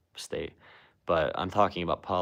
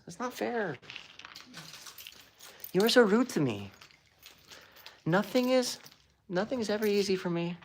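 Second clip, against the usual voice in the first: put-on much higher whining teenage girl voice; whiny teenage-girl Trump; 12 semitones higher than usual. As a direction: complain to Dad like a sulky teen. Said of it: "He sounds like a prepubescent teenage girl".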